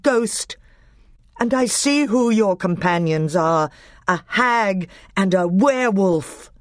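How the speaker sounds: thundering king. A narrator's reading. Caspian denouncing Nickabrick, thunderous anger. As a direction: confront the traitor with royal anger. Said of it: "rose the voice of King Caspian like thunder".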